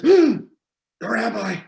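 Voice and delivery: high, loud startled cry; alarmed boy. Acted. Boy warns his friends of the rabbi, alarmed. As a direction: blurt it out like a kid caught in mischief.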